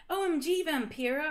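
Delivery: high, loud gushing greeting; hammed-up excited shout; excited yell. A narrator's reading. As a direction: squeal it at a long-lost friend. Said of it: "I yielded".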